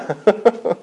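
brief high chuckle; short laugh. Real, not acted. Speaker amused by his tongue-tied footballer bit, short chuckle. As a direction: chuckle at your own joke.

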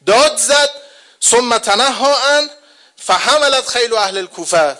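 high, loud epic battle chant; rising martial sermon chant; heroic preaching. Acted. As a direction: drive the charge with fervour.

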